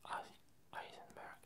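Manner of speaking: ordinary talk, nothing marked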